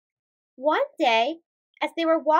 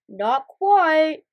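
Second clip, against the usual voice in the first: Big Boss; 5 dB louder than usual. Acted. Big Boss answers guess.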